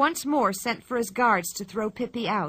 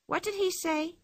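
Pippi voice; 9 semitones higher, 3 dB quieter than usual. Acted. Pippi asks Tommy.

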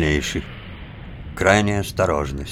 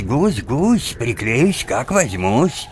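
higher, slower eager recital; eager subordinate; 6 semitones higher, slower than usual. Shown as a demonstration. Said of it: "представление называлось".

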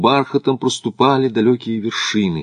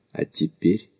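soft and lower, with restrained emotion; quiet, moved question; 3 semitones lower, 7 dB quieter than usual. A narrator's reading. Макдональд asks Смит about his hopes, quietly. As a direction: ask it hushed, suddenly stirred. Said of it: "с неожиданным волнением тихо спросил Макдональд".

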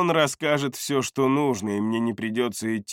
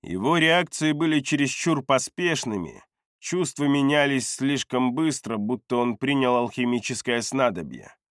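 plain reading voice

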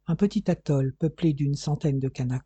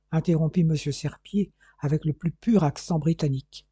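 plain reading voice

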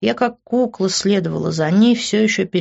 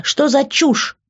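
exclaimed; 7 semitones higher, slower than usual. A narrator's reading. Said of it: "воскликнула я".

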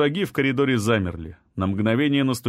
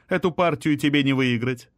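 commanding queen; 3 semitones higher than usual. A narrator's reading. Queen dismisses her rival.

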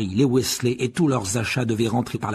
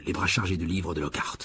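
plain reading voice

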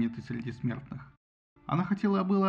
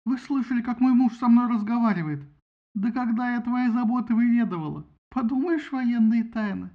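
высоко и громко, скандально; закати скандал мужу; возмущённая жена; 7 semitones higher, 5 dB louder than usual. A narrator's reading. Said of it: "возмутилась Гера". (возмущённая тирада жены)

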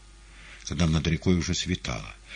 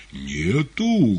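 louder menacing roar; menacing bass roar; 6 semitones higher, 6 dB louder than usual. A narrator's reading. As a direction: roar it like a threatening cook. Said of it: "грозным басом взревел повар".

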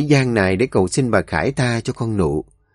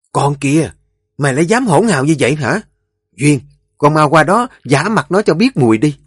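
stern scolding; 4 semitones higher than usual. Acted. Mother-in-law scolds, orders slap.

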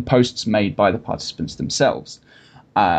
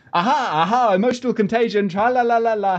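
high triumphant sing-song crowing; gloating sing-song; 9 semitones higher than usual. Pretend. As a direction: crow it out, smug and singsong. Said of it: "the authors concluded".

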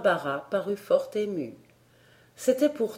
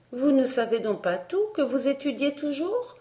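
put-on curious girl; 7 semitones higher than usual. A narrator's reading. Child Elsie questions her governess.

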